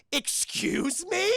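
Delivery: high, slow, drawn-out disbelief; mock affront. Guy answers kid's insult, slow high mock affront. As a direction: stretch it out as if insulted.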